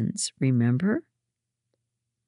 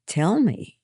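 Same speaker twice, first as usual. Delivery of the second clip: short, higher-pitched demand; 5 semitones higher, 3 dB louder than usual. A narrator's reading. Hannah presses for an answer, demanding. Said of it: "Hannah demanded".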